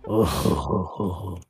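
low gloating villain laugh, fading out; Dio laugh. Acted. Relishing a friend's bad day, low mock villain laugh. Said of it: "that Dio laugh".

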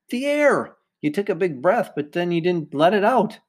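high, insistent coach explaining a mistake; exasperated correction. Acted. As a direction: pitch it up, spelling out the obvious.

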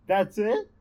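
high, loud, incredulous laughing squeak; mock unimpressed girl, laughing; awkward laugh. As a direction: laugh it out in disbelief. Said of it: "does this awkward laugh".

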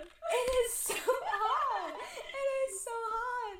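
stretched out, high and laughing; swooning laugh. Real, not acted. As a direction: melt into giggles over the words. Swooning over imagined man.

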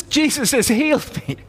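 high-pitched, astonished echo; retold amazement. Healed man repeating the words whispered to him, amazed. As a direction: repeat it in stunned, high-voiced wonder. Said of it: "He says".

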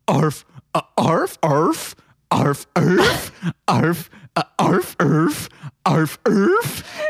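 laughing, goofy dog bark beatbox. Pretend. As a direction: bark out a beat like a silly dog. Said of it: "a beatbox that's just a dog walrus barking".

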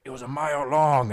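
Irish pub chant call; Irish cheer leader. Retold or imitated. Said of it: "He's like".

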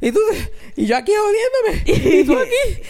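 very high, hammed-up whine through laughter; mock marital quarrel. Podcaster imitating singer fighting his wife in song, mock high wailing. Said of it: "empezó a pelear con su mujer de verdad".

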